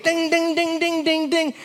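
high, ringing dings on one note; dinner chime imitation. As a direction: imitate a triangle chime being rung, high and rapid. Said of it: "she would ring it".

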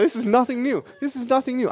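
high, light sing-song brush-off; mock-breezy unconcern; dismissive. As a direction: wave away the familiar excuses. Child brushes off vendor's excuses.

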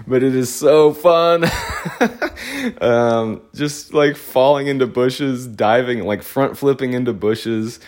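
high, giddy laughter through the words; can barely get it out for laughing. Real, not acted. Remembering bush diving with Andy, giggling high.

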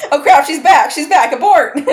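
mock panic through laughter; laughing. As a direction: laugh while faking alarm. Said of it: "We're like".

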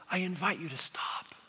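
soft unvoiced whisper. Real, not acted. Preacher gently urging the congregation, whispered. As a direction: breathe the words gently, no voice.

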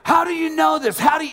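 loud, high-pitched angry outburst; angry demand. Retold or imitated. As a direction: fire the question out, voice leaping up. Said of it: "and he's getting more angry".

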